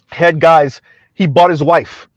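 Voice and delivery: normal talking voice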